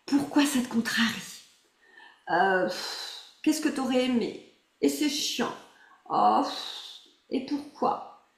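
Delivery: voix de guide, lente et posée, qui fait revivre la contrariété; exercice guidé. Acted. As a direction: guider doucement vers le souvenir agaçant. (la coach guide le groupe dans l'exercice, voix posée)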